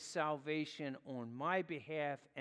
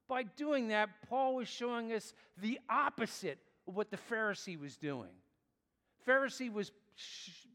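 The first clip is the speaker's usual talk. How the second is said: raised, louder preaching voice; impassioned preaching; 5 semitones higher, 4 dB louder than usual. Acted. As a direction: press the point home to the congregation. Preaching at full force.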